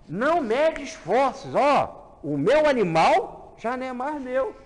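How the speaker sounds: forte e lento, generoso; samaritano enfático. Acted. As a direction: declara com generosidade. Samaritano fala do animal. (samaritano falando do animal, enfático e forte)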